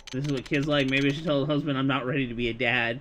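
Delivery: usual speaking voice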